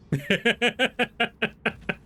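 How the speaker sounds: high, pained laugh of disbelief; disgusted laughter. Real, not acted. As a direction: laugh like you can't believe it. Laughing at grim silk fact.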